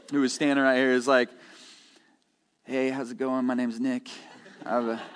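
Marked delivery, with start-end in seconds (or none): 2.6-5.0 Nick Serene impression. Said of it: "my Nick Serene impersonation".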